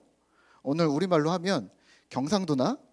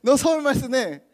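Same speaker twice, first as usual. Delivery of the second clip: high, loud feigned surprise; hammed-up amazed reaction, pitched way up; mock astonishment; 8 semitones higher, 7 dB louder, slower than usual. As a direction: exclaim as if amazed at someone's speech. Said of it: "이런 거죠".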